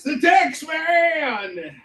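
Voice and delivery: high, drawn-out heroic shout; superhero announcer. Acted. Creator revealing his invented superhero, long high announcer shout. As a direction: stretch the name out like a hero reveal.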